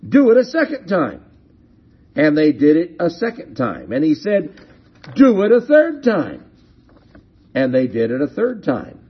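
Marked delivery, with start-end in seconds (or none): 0.1-1.2 high, quick, raised-voice order; commanding. Acted. Prophet's order acted out. 5.1-6.4 high, loud order; commanding. Acted. Prophet's order repeated, acted out.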